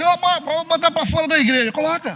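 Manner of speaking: high, loud throw-them-out voice; mock pastor shout. Pastor mocking himself, high and loud. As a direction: bark it out like a strict pastor. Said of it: "Aí você fala assim".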